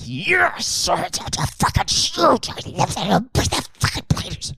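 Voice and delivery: high, slow, breathy awe at the plane; hammed-up starstruck awe; dopey fan. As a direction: gush like a dazzled fan. Americans gushing over a plane.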